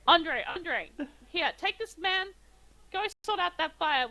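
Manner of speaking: lightly voiced official giving orders; mayor summoning guard. Mayor calls guard over.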